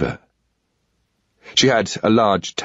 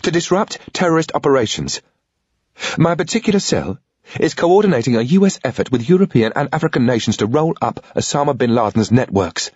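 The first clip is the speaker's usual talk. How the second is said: a bit louder; 7 semitones higher than usual. Acted. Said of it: "Her voice got a bit louder".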